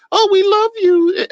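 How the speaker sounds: high, slow sing-song "we love you"; mock cultist. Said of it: "Mindless sheep talk that way".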